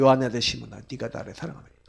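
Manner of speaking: low and soft, falling away at the end; gentle questioning Jesus. Retold or imitated. Jesus gently asking Peter at breakfast, soft. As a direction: ask tenderly, not accusing.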